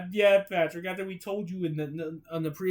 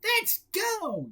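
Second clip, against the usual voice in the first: eager. Acted. SpongeBob cheering.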